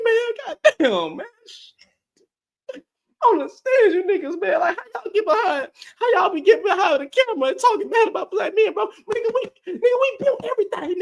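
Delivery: high, hammed-up fake crying voice with laughs; mock sobbing complaint from a wounded bro; mock wounded wail. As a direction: play a man pretending to be deeply hurt, over the top. Mocking men crying over criticism.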